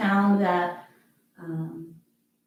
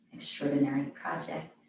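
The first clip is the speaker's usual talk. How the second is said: guided meditation; 3 semitones lower, 4 dB quieter than usual. Acted. Yoga teacher guiding students to rest, softly meditative. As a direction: ease the class into the breath.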